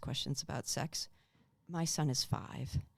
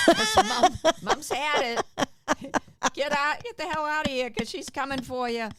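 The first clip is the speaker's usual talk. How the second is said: loud and high, laughing through a mock yell; hammed-up furious mom warning the kids; mother yelling at her boys, mock furious; mock angry mom yell; 8 semitones higher, 9 dB louder than usual. Mom losing it, kids warned.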